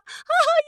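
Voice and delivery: high cackling mock laugh. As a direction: laugh at her pain. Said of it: "she was like".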